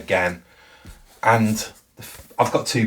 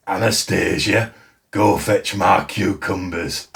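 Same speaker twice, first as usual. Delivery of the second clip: slow, hammed-up Yorkshire accent; bad Yorkshire accent. Teasing sister about her reading in a bad Yorkshire accent. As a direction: put on a really bad Yorkshire accent, drawn out. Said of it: "like, this really bad Yorkshire accent".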